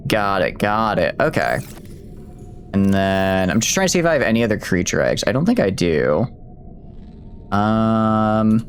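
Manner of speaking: ordinary delivery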